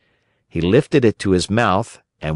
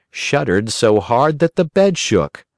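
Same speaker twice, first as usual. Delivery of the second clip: usual speaking voice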